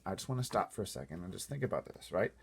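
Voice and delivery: ordinary delivery, nothing marked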